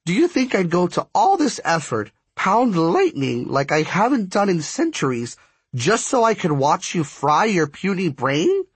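high, scornful goddess-like scolding; exasperated spark striker. A narrator's reading. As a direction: berate a foolish mortal in a high voice.

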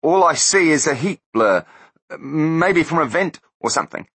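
high and loud, nervous excuse; scared denial. A narrator's reading. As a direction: talk yourself out of fear. Terrified hitman.

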